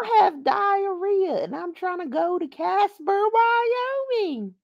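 high, slow whiny parody voice; hammed-up imitation of a crying girl; mock crying whine. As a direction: mimic her sobbing complaint, overdone. Mocking the crying streamer.

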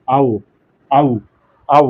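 আউ as one gliding vowel, a to u; demonstrated diphthong. Shown as a demonstration. Teacher correcting a common mistake, careful demonstrated আউ. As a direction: glide clearly through each আউ. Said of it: "আউ হবে এরকম".

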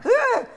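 short, high startled gasp-cry; a sudden loud yelp of alarm; startled scream. Retold or imitated. Bystander reacting to screams at the airport, startled cry. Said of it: "when people start screaming".

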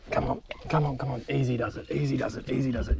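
soft, hushed coaxing; soothing coax. Real, not acted. Handling a big barra, softly coaxing it. Coaxing a landed fish gently.

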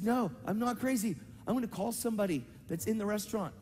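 high-pitched insistence; re-enacted urgent protest. Protesting to hysterical woman.

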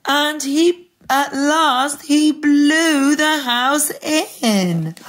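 slow build, rising high and loud; drawn-out dramatic narration; suspenseful storytelling. A narrator's reading. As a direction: stretch each phrase towards the climax.

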